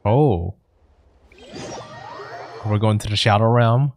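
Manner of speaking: ordinary delivery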